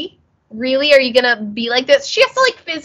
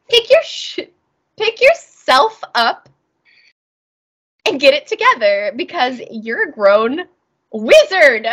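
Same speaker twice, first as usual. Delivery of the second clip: scolding mother; 3 semitones higher, 3 dB louder than usual. Retold or imitated. Sophie scolding sulking Hal to pull himself together. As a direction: nag him like an exasperated mother. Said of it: "She's like".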